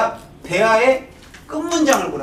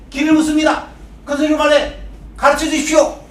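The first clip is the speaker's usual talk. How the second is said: high, raised-voice exchange; loud dialogue reading; 6 semitones higher, 4 dB louder than usual. Acted. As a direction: call it out to someone hard of hearing. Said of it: "귀 먹었으니까 큰 소리로 말해". (귀먹은 사람에게 외치듯 큰 소리로)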